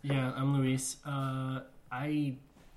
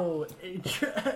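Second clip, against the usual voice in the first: mock awe; 5 semitones higher, much slower than usual.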